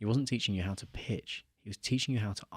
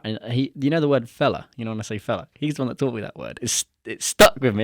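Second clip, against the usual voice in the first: ordinary talk, nothing marked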